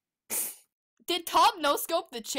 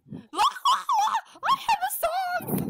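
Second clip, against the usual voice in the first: excited squeal. Real, not acted. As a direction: call out thrilled. Excited shouting.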